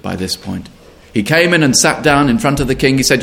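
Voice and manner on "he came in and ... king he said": ordinary delivery, nothing marked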